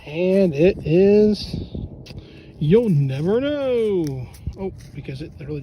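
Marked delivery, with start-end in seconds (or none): 0.1-4.3 slow, loud drawn-out teasing reveal; teasing suspense. Pretend. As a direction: build fake suspense, then snatch it away. Teasing reveal of beer cap.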